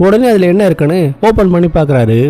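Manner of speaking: ordinary delivery, nothing marked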